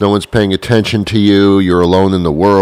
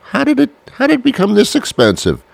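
much higher, squeaky outraged disbelief; shocked squeak. Retold or imitated. Shopper baffled by prices, squeaky high voice.

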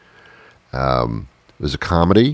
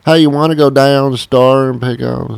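high, louder Southern Tennessee twang; Knoxville twang; 9 semitones higher, 5 dB louder than usual. Retold or imitated. Knoxville local asking a friend to the store, in a high loud Southern twang. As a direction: call it out like a laid-back Knoxville local with a twang. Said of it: "they speak with an accent, a little twang".

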